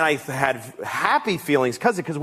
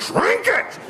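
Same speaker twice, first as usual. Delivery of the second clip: high, desperate yelp of drink it; frantic command. Retold or imitated. Owner struggling with dog, frantic high command. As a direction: shriek it at a thrashing dog.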